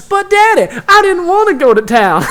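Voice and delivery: loud, high hammed-up Southern belle; over-the-top Southern girl audition; Southern accent. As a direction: whine to Daddy in a big Southern accent. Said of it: "she does, like, a Southern accent".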